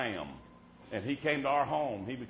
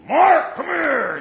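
a loud, high holler across the house; dad hollering; 15 dB louder, much slower than usual. Retold or imitated. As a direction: call the boy in with a big yell. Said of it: "My dad would holler at us boys".

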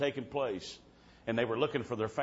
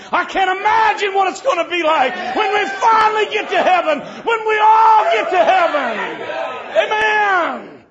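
preaching shout; 14 dB louder than usual. Real, not acted. Preacher telling the church about heaven, shouting for joy.

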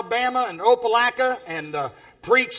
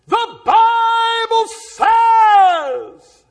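slow, drawn-out booming proclamation; booming preacher shout; 10 semitones higher, 8 dB louder, much slower than usual. Retold or imitated. Street preacher addressing bikers across the street, slow booming shout. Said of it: "when I yelled that across there and it boomed out".

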